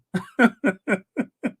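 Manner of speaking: long, high staccato laugh; real run of amused chuckles; laughing at own joke. Real, not acted. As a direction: crack up after your punchline.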